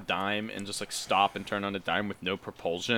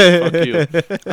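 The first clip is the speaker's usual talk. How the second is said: mock shout; 7 semitones higher, 13 dB louder than usual. Mock retort to teasing friend.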